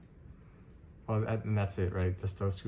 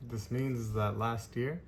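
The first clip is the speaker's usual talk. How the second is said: ordinary talk, nothing marked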